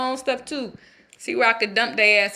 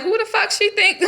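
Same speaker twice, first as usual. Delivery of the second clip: indignant outburst; 9 semitones higher, 4 dB louder than usual. Retold or imitated. Husband fuming at his wife's remark, high indignant outburst. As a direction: pitch jumps way up, louder and outraged. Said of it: "And then I'm like".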